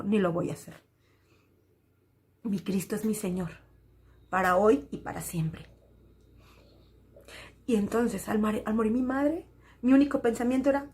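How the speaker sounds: usual speaking voice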